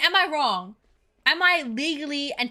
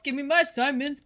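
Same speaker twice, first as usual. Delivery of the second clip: ordinary delivery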